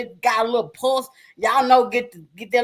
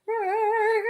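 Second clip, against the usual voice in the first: cat purr; 12 semitones higher, 6 dB quieter than usual. Retold or imitated. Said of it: "that cat got the purr".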